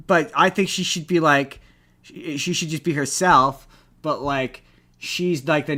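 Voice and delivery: ordinary talk, nothing marked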